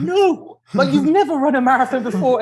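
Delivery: high-pitched protesting disbelief; imitated incredulous coach. Coach reacting to his runner's wild plan, mock incredulous. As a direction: object in a high, amused voice.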